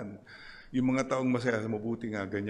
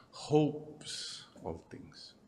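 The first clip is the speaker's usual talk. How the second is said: whispered; 4 semitones higher, 4 dB quieter, much slower than usual. Acted. Solemn list of love's traits.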